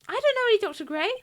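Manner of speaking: high, fast airhead denial; defensive ditzy girl. Acted. Medium girl denying she knew the victim, ditzy and fast. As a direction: blurt it out nervously. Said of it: "people who used to talk like this".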